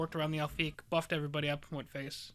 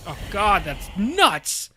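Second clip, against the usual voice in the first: shocked dismay; 3 semitones higher, 10 dB louder, much slower than usual. Real, not acted. Opponent topdecks the answer card.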